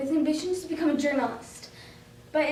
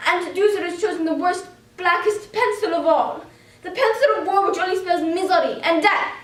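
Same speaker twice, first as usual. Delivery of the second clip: bitter dramatic declamation, growing louder; indignant diary monologue; 2 semitones higher, 5 dB louder than usual. Acted. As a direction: build in force as you accuse.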